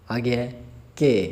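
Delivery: ordinary talk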